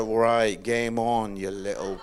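loud, low mock threat; challenged dad. Dad squaring up to his teenage daughter, mock combative. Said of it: "I read that as a".

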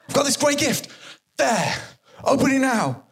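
can't wait for them to open it; eager pushy friend. Pretend. Eager friend pushing a present.

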